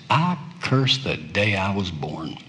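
soft, low and hushed; despairing murmur. Acted. Preacher voicing Jeremiah's despair to God, hushed. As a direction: breathe out the curse like a broken man. Prophet's despairing prayer quoted.

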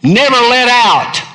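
high, loud and slow; anguished lament. Acted. Preacher voicing Jeremiah's wish never to be born, loud lament. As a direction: wail the wish to heaven.

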